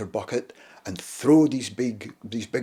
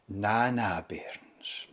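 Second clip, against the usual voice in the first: put-on quiet Shetland accent, last word almost whispered; gentle Shetland crofter, hushed and unhurried; soft Shetland accent; 3 semitones lower, 6 dB quieter, much slower than usual. Gentle crofter calming the children, soft Shetland accent. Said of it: "in his Shetland accent".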